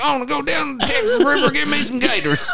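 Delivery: put-on lightly done Texan accent, pitched high; playful imitation of a Texan, up high; mock Texan accent. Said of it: "he's very good".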